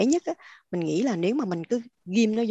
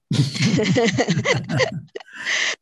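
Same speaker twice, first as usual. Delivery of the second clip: a burst of real laughter at the story; hearty laugh; 4 dB louder than usual. Real, not acted. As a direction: laugh out loud, amused.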